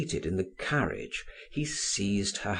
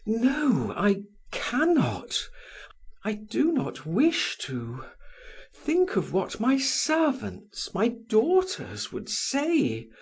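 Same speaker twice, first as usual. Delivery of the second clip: broken voice; 11 semitones higher than usual. A narrator's reading. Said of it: "in broken accents".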